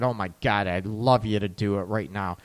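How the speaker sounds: low, soft eager gasp; breathy eager reply. Retold or imitated. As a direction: sigh it low, wanting it now. Voicing woman's eager reply.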